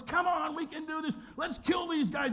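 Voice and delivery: high, urgent battle yell; rallying shout. Retold or imitated. Camp urging each other to attack, rallying shout. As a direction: yell it like you're rousing a mob.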